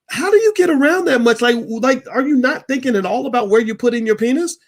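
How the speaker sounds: high-pitched, exasperated disbelief, loud at the start; incredulous rebuke. Retold or imitated. Telling off a college friend about his kids, incredulous rebuke.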